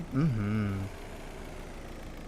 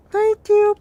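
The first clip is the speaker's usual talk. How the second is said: very high, loud little imitation; imitating a cute, muffled customer; 8 dB louder than usual. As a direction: squeak out a cute muffled thanks. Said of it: "in the muffled voice they give".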